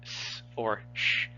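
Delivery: s as a hiss, sh as a hush; s and sh as bare consonant sounds; isolated letter sounds. Shown as a demonstration. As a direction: sound out s and sh on their own. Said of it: "they never pronounced the C as".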